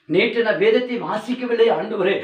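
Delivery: high, loud fiery sermon; preaching at full force. Acted. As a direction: thunder the warning at the congregation. (உரத்த பிரசங்கக் குரல்)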